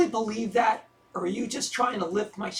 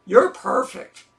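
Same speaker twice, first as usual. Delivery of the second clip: louder, mock praise with a dry edge; sarcastic; 7 dB louder, slower than usual. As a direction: say "perfect" so it plainly means the opposite.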